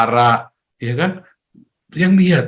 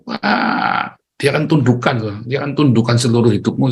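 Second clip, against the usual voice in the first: normal talking voice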